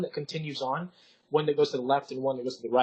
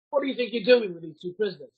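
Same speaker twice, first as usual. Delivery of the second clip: lightly voiced guard, raised and louder; a hint of a suspicious guard; challenging guard; 5 semitones higher, 5 dB louder than usual. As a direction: challenge them like a guard on duty.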